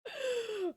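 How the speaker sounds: high, drawn-in gasp; shocked gasp. Real, not acted. Streamer hears a sudden blast of game audio, shocked gasp. Reacting to loud game sound.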